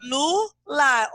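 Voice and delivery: "celular" slowly split as ce, lu, lar; syllable by syllable. Shown as a demonstration. Teacher shows pupils how many syllables, syllable by syllable. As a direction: count out "celular" with gaps between syllables. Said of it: "Quantas sílabas tem a palavra celular?".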